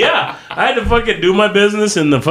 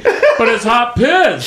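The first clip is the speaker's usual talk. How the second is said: slow, higher, laughing through hot piss; laughing protest; 7 semitones higher, much slower than usual. Retold or imitated. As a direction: plead it slowly while cracking up. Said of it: "I was like".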